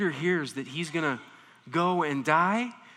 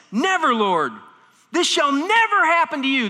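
loud, higher cry of never; exclaiming; 8 semitones higher, 8 dB louder than usual. Retold or imitated. Peter refusing Jesus' death, loud exclamation. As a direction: exclaim it with full intensity. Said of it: "These are both said with exclamation".

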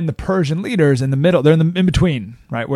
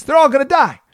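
ordinary talk, nothing marked

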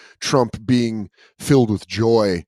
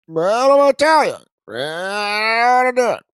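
Joe Biden voice; 9 semitones higher, 6 dB louder than usual. Retold or imitated. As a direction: punch it out in bursts. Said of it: "He seems to speak in bursts".

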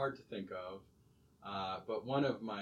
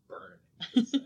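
laughing; 9 semitones higher, 8 dB louder, faster than usual. Real, not acted. Laughing retort.

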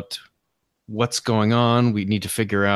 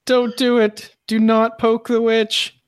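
pleading warning; 10 semitones higher, 3 dB louder than usual. Acted. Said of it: "screaming to back away from her".

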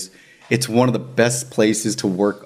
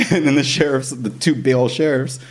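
ordinary delivery

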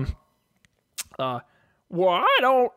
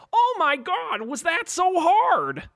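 higher, slow fed-up sigh of a genie; exasperated genie exclaim; 8 semitones higher, slower than usual. Acted. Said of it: "The genie exclaims".

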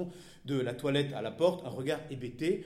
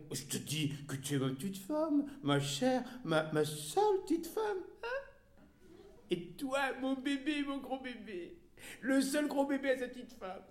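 put-on baby talk; 11 semitones higher than usual. Husband coos baby talk.

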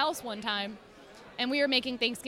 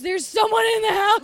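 mock alarmed; 9 semitones higher, 9 dB louder than usual. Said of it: "He goes".

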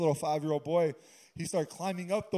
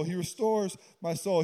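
ordinary talk

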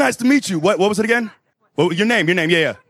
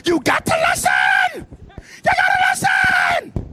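very high, drawn-out shriek; squealed, stretched-out scolding; mock exasperated screech; slower than usual. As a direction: screech it at a pupil who won't listen. Scolding interviewer for not listening.

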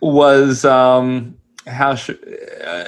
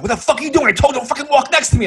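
higher mock yell, words tumbling out fast; furious teen voice; 10 semitones higher, much faster than usual. Teen snapping at the manny, mock furious yell. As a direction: spit it like a bratty kid. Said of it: "He's like".

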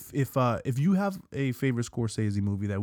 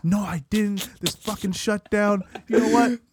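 higher, louder, fed-up worker laughing; fed-up TSA agent; 8 semitones higher, 5 dB louder than usual. Retold or imitated. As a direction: throw up his hands and quit. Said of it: "They're like".

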